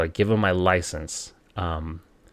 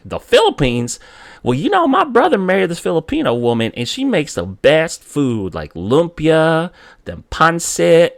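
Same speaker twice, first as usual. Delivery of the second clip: high, loud, gushing cop impression; suddenly delighted cop; 9 semitones higher, 8 dB louder than usual. Cop warms to a Filipino driver, excited gushing. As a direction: gush about the food, drawing out each dish. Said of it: "his whole demeanor changed".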